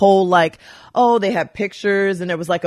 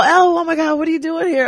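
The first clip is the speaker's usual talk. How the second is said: excited greeting; 9 semitones higher than usual. Retold or imitated. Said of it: "He calls me L".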